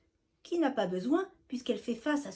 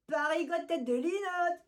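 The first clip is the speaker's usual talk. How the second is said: taunting exclamation; 7 semitones higher than usual. Acted. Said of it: "s'exclament les autres compères".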